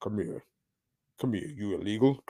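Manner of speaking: low, soft attempt at a sexy cowboy; sexier cowboy. Host retrying his cowboy voice to sound sexier, mock-sultry cowboy. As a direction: drop it down low and quiet, badly faked seductive cowboy. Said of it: "It should be a little more sexier".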